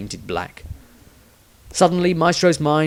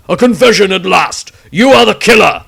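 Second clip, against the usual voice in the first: loud, higher accusing shout; triumphant accusation; 9 semitones higher, 12 dB louder than usual. A narrator's reading. Witch hunter accusing the wizard, loud triumphant shout. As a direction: pounce on the confession. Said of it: "said Malvanius".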